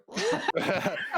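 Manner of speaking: high bursting laugh; burst of laughter. Real, not acted. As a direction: laugh out loud at yourself. Laughs at own retreat.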